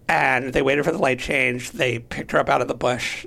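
ordinary delivery

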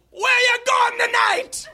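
put-on high, drawn-out Jamaican-accented shout; Jamaican cab driver. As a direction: yell it in an over-the-top Jamaican accent. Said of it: "my Jamaican accent".

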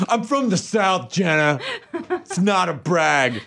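high, slow Southern drawl; exaggerated Southern drawl. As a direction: boast with a stretched-out Southern accent.

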